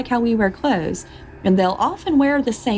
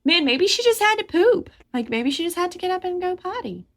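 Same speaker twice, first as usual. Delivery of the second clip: husband wondering; 11 semitones higher than usual. Retold or imitated. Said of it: "that he never even thought".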